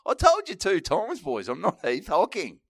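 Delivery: high loud mock outrage; hammed-up betrayed yelling; mock indignant fans. Shattered fans yelling at the speaker, mock outraged. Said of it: "they said".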